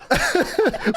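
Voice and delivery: high squealing laughter; cracking up at the recap; helpless high laugh. Real, not acted. Cohost cracking up at the wrestling story, high helpless laugh.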